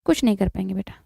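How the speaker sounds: normal talking voice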